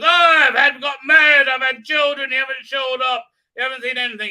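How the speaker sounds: loud, high mock outburst; hammed-up angry complaint; pissed-off rant. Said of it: "he's really pissed off 'cause".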